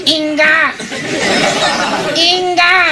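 put-on high, sustained "inga" baby cry; drawn-out imitation of a bawling newborn; newborn's wail. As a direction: mimic a newborn baby's first cry. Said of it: "El niño recién".

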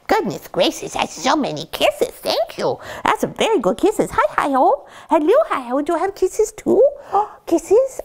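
high, swooping cooing. Real, not acted. Owner getting kisses from puppies, high cooing baby talk. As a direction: gush in a high sing-song to the puppies.

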